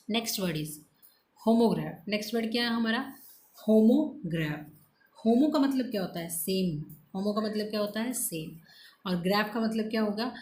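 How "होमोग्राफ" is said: slow drilled word. Shown as a demonstration. Teacher drills new word.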